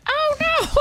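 high squeaky "oh no"; mock dismay. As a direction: pipe it up in a tiny voice. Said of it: "everyone was like".

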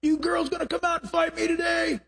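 Goliath taunting. Retold or imitated. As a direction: taunt the army like a bully giant. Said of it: "Goliath got up and yelled".

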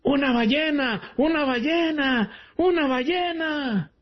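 high-pitched mock shouting; hammed-up calling out, stretched at the end; joke drunkard's cry. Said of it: "empezó a gritar".

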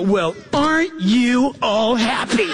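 mock sneering coworker with a laugh; lightly hammed-up resentful colleague teasing; sarcastic coworker. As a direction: jab at the cheerful one sarcastically. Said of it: "And they'll go".

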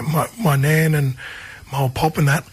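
ordinary talk, nothing marked

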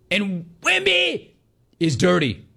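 mocking high-pitched jab at Wemby; mock high squeal. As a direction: squeak out the name in a taunting falsetto. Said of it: "he better yell at the top of his lungs".

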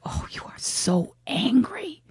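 slow, breathy stage whisper; imitating a snide reality-star whisper; mock whisper. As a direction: hiss it condescendingly like a TV housewife. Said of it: "Lisa Rinna just looks at her and says".

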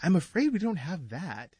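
high, soft, swooping apology; meek shopman, read aloud for children; storybook shopkeeper. Acted. Voices shopkeeper in children's book.

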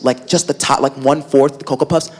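usual speaking voice

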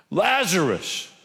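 drawn-out high call of the name; mild slow call to the tomb. Retold or imitated. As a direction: stretch the name out like a summons. Jesus calling slowly.

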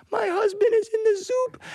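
high-pitched mock sobbing wail; crying. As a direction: wail like a hammed-up grieving wife. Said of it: "why are you crying".

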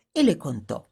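ordinary delivery

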